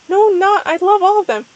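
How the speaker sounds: high, loud character outburst; hammed-up distressed denial; upset protest. A narrator's reading.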